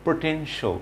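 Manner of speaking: "পটেনশিয়াল" ending in a short "sho"; correct pronunciation. Shown as a demonstration. Teacher models the word for learners, correct pronunciation. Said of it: "শো".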